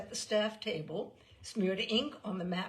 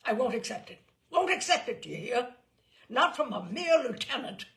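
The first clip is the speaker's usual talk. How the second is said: loud, rising indignant rant; 5 semitones higher, 8 dB louder than usual. A narrator's reading. General refuses the umpire's verdict, ranting louder. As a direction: pound each refusal harder.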